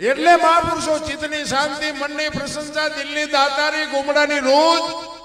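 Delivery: loud, high preaching list; rousing preaching. Acted. Preacher lists the virtues, loud and rousing. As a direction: build it up for the crowd.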